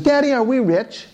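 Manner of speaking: put-on high, sweet little-girl voice asking daddy. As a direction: voice a small daughter asking her father. Little daughter asks her father.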